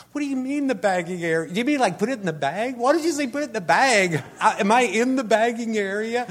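high, loud, fast mock outrage; shrill-edged comic indignation at the self-checkout; exasperated retort. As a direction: fire back at the machine in disbelief. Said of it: "And I'm like".

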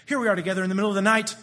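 rapid-fire recited lyric; quick patter delivery; very fast. Acted. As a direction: rattle it off at speed. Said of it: "she sings it just that fast".

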